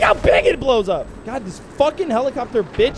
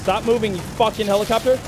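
normal talking voice

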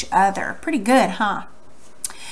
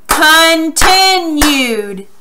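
"Continued" very slow and higher, in three beats; syllable by syllable; 4 semitones higher, 4 dB louder, much slower than usual. Shown as a demonstration. Teacher leading slow claps, "Continued" syllable by syllable. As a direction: stretch each syllable of "Continued" for a clap. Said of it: "don't clap too fast".

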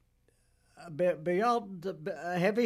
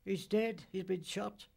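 ordinary delivery

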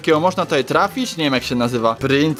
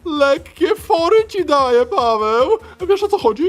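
high squeaky hamster voice; chipmunk; 12 semitones higher, 3 dB louder than usual. Acted. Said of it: "Ja, chomik świr".

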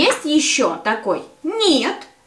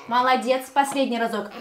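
ordinary delivery

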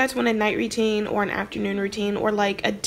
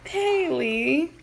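cooing voice; 8 semitones higher, slower than usual. Real, not acted. Cooing over the baby sim.

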